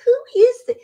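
sudden high, loud surprise; startled question. Retold or imitated. Woman startled by a stranger's voice, high and loud. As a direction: jump at a voice from nowhere. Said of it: "And I thought".

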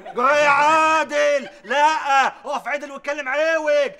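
slow, drawn-out loud rebuke; loud scolding. Acted. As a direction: drag out his name to scold him. Partner scolds Adel, drawn out.

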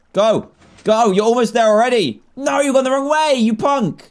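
high, loud urging at a stray zombie; excited urging. Real, not acted. Gamer yelling at his zombie to go the right way, excited. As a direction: cheer it on, then scold it.

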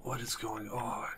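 whisper, barely breathing; a tense, frightened whisper; scared whisper. Real, not acted. Hiding in a scary game.

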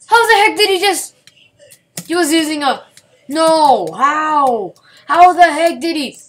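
high, loud disbelieving shout; outraged yelling. Real, not acted. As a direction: yell at the screen in shock. Outraged at opponent's move.

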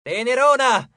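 high, loud pet name; shouted taunt. A narrator's reading. Percy goads the goddess with pet names, shouting. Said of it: "gridò".

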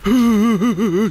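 loud, high startled laugh; nervous burst of laughter. Real, not acted. Player caught by the owner, loud nervous laughter. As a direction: crack up in panic.

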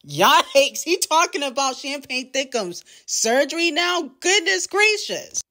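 scandalized commentator losing it; shocked exclaim. Real, not acted. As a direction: gasp it out in disbelief, pitch climbing. Shocked by surgery diss line.

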